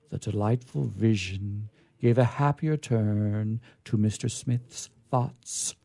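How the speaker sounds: usual speaking voice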